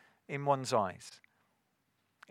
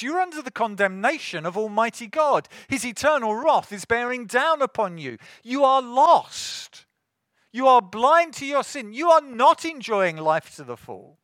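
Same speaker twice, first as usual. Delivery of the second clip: loud, raised-pitch pulpit warning; full-force preaching; 6 semitones higher, 10 dB louder than usual. Real, not acted. Preacher warns listeners they are under God's wrath, full-force preaching. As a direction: press the warning home, louder and higher.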